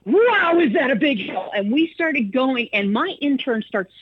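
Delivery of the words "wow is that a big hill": high, excited radio patter climbing the hill; excited host. Retold or imitated. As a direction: sell the thrill of the climb to listeners. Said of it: "I said".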